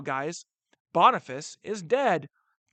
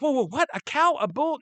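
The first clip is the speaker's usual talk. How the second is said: higher, puzzled questions as an imagined listener; mock confusion, pitched up; baffled student; 6 semitones higher than usual. Teacher voices a student confused by a papal bull, baffled. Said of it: "Now you might be like".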